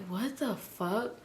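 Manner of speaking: slow, hushed disbelief; dazed and soft; stunned murmur. Retold or imitated. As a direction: drag it out under your breath. Waking confused in strange room.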